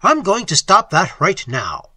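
exclaimed. A narrator's reading. Narrator sets off to stop Gru, exclaiming forcefully. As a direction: declare it hotly and loudly. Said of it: "I exclaimed".

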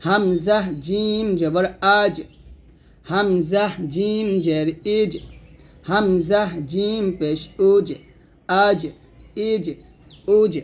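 slow spelling, final জ of আজ, ইজ, উজ bounced; qalqalah on jim. Shown as a demonstration. Teacher showing learners the letter jim, echoing জ in আজ, ইজ, উজ. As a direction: let the জ in আজ, ইজ, উজ spring back briefly. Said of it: "জিমটা হবে".

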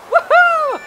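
high, loud woohoo; celebratory whoop. Real, not acted. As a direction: whoop with joy. Cheers on getting the rod.

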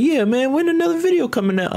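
high squeaky voice, rushed, mocking the fans. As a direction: squeak it like a nagging fan. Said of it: "they was like".

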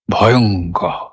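low, drawn-out word full of feeling; expressive dread. Shown as a demonstration. Teacher shows how the word carries its feeling, expressive dread. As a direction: let ভয়ঙ্কর carry the dread, low. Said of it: "ঠিক যেভাবে অনুভবটা প্রকাশ করেছিলাম".

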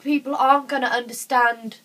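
ordinary talk

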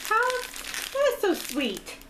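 high, slow, swooning; touched gush. Real, not acted. Mother touched by a gift for her son, high slow gush. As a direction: melt over how sweet it is.

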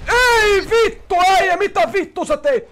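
loud, high, drawn-out furious yell at a teammate; real outrage, stretched first words; angry shout. Real, not acted. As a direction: blow up at your buddy. Teammate wrecked the heist.

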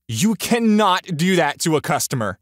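high, loud indignant yell; yelling back. A narrator's reading. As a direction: yell back like an outraged customer. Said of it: "the woman yells back at me".

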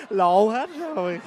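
loud laugh, caught out; bursting laugh. Real, not acted. Stepsister caught out after misspeaking, laughing loudly. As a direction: laugh at your own slip.

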